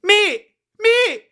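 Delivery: high, loud cry of thanks; overjoyed mock yell of gratitude; grateful shout. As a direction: call him mate like he saved your life. Thanking the dealer, retold.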